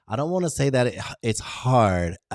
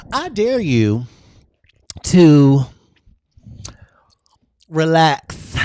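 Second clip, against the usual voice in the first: higher and louder, a big excited dare; 5 semitones higher, 8 dB louder, much slower than usual. Acted. To her live viewers, a high loud excited dare. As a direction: push the dare out with full energy. Building repeated dares to a peak.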